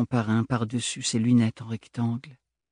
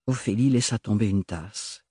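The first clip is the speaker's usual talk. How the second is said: usual speaking voice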